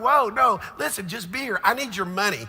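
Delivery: loud, high, choked-up pleading; tearful shout. Retold or imitated. Pastor reliving telling his friend to stay, tearful loud plea. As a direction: cry it out loud with a laugh at the end. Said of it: "Man, I'm crying".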